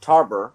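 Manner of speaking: "Tarboro" as two syllables, TAR-ber; local pronunciation. Shown as a demonstration. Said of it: "You pronounce it T A R B E R".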